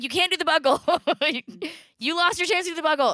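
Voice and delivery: high, loud retold scolding; fed-up parent laying down the law; exasperated refusal. Mother refusing daughter the buckle, exasperated.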